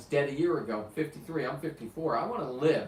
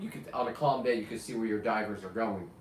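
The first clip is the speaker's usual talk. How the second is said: normal talking voice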